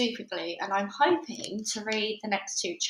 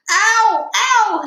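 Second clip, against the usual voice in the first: high, loud pained yells; father yelling in pain, very high and loud; screaming; 12 semitones higher, 14 dB louder than usual. Acted. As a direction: scream as the hat rips at his skin. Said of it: "he screamed".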